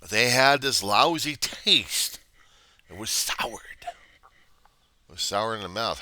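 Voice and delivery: slow, loud disgust, then a whisper. Retold or imitated. Man recalling vegetables his mother forced on him, in slow disgust. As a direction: savour the disgust.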